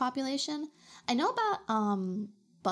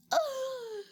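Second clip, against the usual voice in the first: high, voiced mock gasp. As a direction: gasp high in pretend offence. Gasp at list of offenses.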